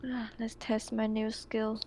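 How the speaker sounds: ordinary talk, nothing marked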